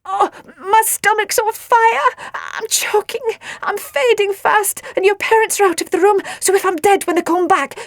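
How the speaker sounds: high, loud, hammed-up dying gasps; gasping. A narrator's reading. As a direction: gasp it out as if choking to death, theatrically. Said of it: "gasped Nelly".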